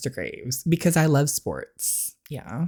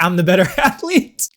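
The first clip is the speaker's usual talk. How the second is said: laughing mock boast; 5 semitones higher, 6 dB louder than usual. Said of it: "About to be like".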